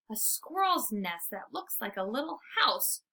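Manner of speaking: ordinary delivery, nothing marked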